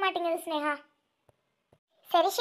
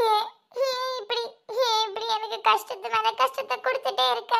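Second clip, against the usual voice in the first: tearful lament; 4 semitones higher than usual. Acted. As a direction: sob the question out to God. Woman laments her endless troubles.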